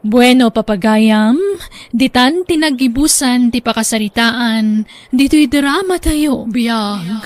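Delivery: loud, bright announcer read; program sign-off. Acted. Host signs off the drama for listeners, announcer style. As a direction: wrap up the show for the listeners.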